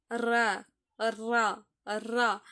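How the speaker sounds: "ரா" rolled off the side of the tongue; articulation demo. Shown as a demonstration. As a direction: sound out "ரா" in separate syllables with a trilled r. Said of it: "நாக்கோட வலது பக்கத்துல". ("ரா" நாக்கின் ஓரத்தால் உருட்டி உச்சரித்தல்)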